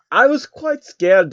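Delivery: high, slow mock fright; mock scared. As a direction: play up the fear, hammed. Jacob voiced admitting fear.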